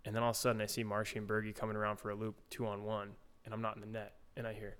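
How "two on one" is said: usual speaking voice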